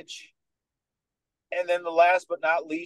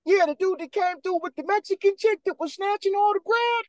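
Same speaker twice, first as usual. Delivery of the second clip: much higher, excited yelling recollection; excited shout. Retold or imitated. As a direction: shout it out like an old poker pal who just remembered. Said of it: "Hey, remember C D K?".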